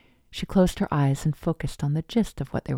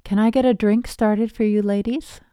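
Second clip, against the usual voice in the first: higher, louder barista call; 6 semitones higher, 5 dB louder than usual. A narrator's reading. Barista offering drinks to seated customers, calling out. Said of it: "she called out".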